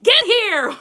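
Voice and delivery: half-playful urgent call; mock call out. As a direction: call a colleague over fast. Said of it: "you're gonna talk enough to like".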